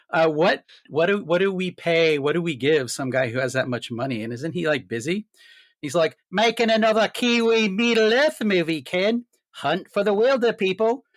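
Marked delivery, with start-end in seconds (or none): 6.3-11.0 put-on higher Kiwi accent, Peter Jackson; put-on New Zealand voice, higher. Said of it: "a very objectionable New Zealand accent".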